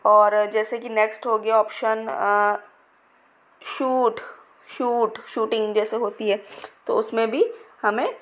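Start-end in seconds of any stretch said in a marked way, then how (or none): 3.6-5.2 शूट with the oo drawn out; teacher modelling the long sound; long stretched oo. Shown as a demonstration. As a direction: pull the vowel long in शूट. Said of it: "खींच के हमने ये बोला है शूट".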